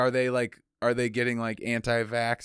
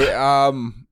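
louder put-on Norwegian accent; mock Norwegian accent; 6 dB louder than usual. Norwegian boat hailing a French one, mock Norwegian accent. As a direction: call out in a jokey Norwegian accent. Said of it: "I'll be Norwegian".

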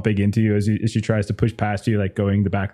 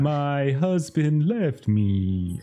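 higher, slow self-pitying moan; mortified receptionist; 5 semitones higher, much slower than usual. Acted. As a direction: mutter regrets while fleeing.

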